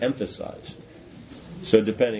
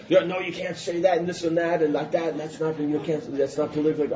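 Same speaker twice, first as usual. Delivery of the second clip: higher, hammed-up protesting organizer; mock protest; 6 semitones higher than usual. Organizers objecting to the blunt speech, in a higher mock-protesting voice. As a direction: mimic the fussy objection, pitched up.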